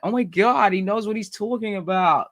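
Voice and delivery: amazed American listener. Retold or imitated.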